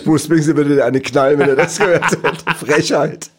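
mock outrage, loud and laughing. Real, not acted. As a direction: scold with a laugh.

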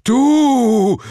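loud, very high cry of astonishment; dumbfounded. A narrator's reading. Matthias crying back at the narrator, dumbfounded. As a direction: blurt it out, utterly baffled. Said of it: "erwiderte Matthias verblüfft".

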